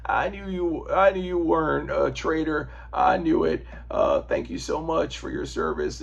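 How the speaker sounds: hint of a president thanking a soldier; Bill Clinton. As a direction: play a folksy president lightly. Bill Clinton quoted thanking Cap.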